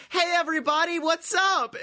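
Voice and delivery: high, overly chipper greeting; creepily happy. Retold or imitated. Natalya greeting fans in the parking lot, creepily happy. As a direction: gush like a too-cheery star. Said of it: "creepily happy".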